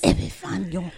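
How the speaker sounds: lightly imitated little raspy voice; playful raspy name-drop; Camila Vargas. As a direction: say it with a hint of her rasp. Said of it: "with her little raspy voice".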